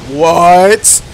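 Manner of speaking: loud, high startled what. Real, not acted. Gamer shocked by the boss's new move, loud startled shout. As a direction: caught off guard by the attack. Boss suddenly gets lightning attack.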